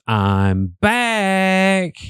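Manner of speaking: high, stretched-out dramatic voice; movie character impression. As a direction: belt it out like a triumphant movie hero. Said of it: "he says".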